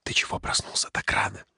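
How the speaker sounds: hushed, voiceless whisper. A narrator's reading. Asking a friend beside sleeping children, whispered. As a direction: whisper it so the sleepers don't hear. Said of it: "спросил шёпотом".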